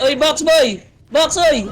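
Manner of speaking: high, urgent yelp to teammates; alarmed callout. Real, not acted. Player suddenly alerting teammates to the box, alarmed callout. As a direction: call out sharply, voice jumping high.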